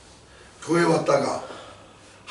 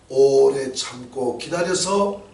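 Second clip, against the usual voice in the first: slow emphasis; 4 semitones lower, much slower than usual. Shown as a demonstration. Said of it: "emphatic slow sermon point".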